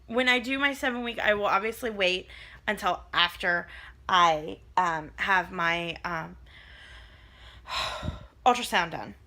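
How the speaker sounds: ordinary talk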